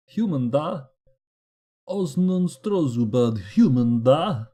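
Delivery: loud, hammed-up Eastern European accent; terrible Eastern European accent. Gamer sounding out a character's name, terrible Eastern European accent. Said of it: "Terrible Eastern European accent".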